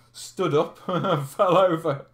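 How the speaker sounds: can't keep a straight face telling it; laughing. Real, not acted. Laughing retelling own fall.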